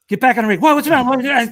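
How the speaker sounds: high, loud frantic calls; retold frantic shouting; retold yelling. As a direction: yell it like panic on the scene.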